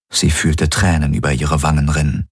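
plain reading voice

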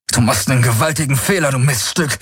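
schnell und gepresst, drohend; wütendes Knurren. A narrator's reading. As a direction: die Drohung hinausknurren. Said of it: "knurrte Galen". (Galen droht Sharati gepresst und wütend)

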